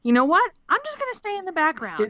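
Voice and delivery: lightly voiced timid Neville; mock Neville. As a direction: squeak it like a timid kid making a promise. Voicing Neville's resolve.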